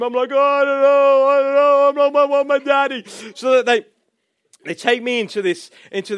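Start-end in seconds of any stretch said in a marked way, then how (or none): 0.3-3.0 high, loud words blurred into a wail; mock bawling. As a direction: inconsolable little boy, hammed up. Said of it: "so I started screaming".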